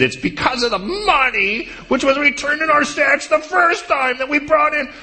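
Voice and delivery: high, panicky voice of the frightened brothers; high fearful pleading; panicked brothers. Pretend. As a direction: squeak it out like scared men.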